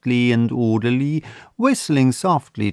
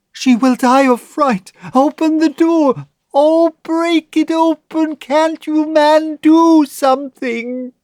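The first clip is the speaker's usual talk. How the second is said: much higher, loud frantic wail; wailing mother; 4 dB louder than usual. A narrator's reading. Mother begging the men to break open the vault. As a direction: beg desperately, voice up high. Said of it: "wailed the mother".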